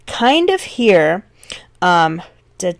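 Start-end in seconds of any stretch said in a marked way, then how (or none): none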